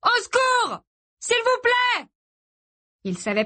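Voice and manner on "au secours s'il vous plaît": very high, drawn-out yell; shouted plea for help. A narrator's reading. Boy calling to a distant couple, desperate shout. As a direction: shout to someone far off. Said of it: "cria Gray".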